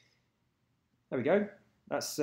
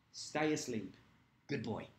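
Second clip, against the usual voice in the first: soft, low-key murmur to a sleeping pet; hushed reassurance; 3 semitones lower, 10 dB quieter, slower than usual. Real, not acted. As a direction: quietly settle a sleeping pet without waking him.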